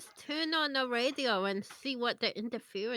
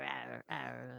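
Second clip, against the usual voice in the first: deeper, softer mock monster growl; a tiny hushed growl, lower still; 12 semitones lower, 13 dB quieter than usual. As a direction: growl low like the toy's little mouth. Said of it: "it's got little teeth".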